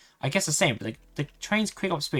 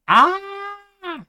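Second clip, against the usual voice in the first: sharp piercing scream far higher; 7 dB louder than usual. Acted. Amy screaming from the next car, piercing scream. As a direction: shriek in sudden terror.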